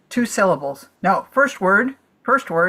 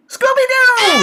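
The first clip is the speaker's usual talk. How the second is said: triumphant high shout; excited shout; 6 dB louder than usual. Real, not acted. Guesser shouting the right answer in triumph.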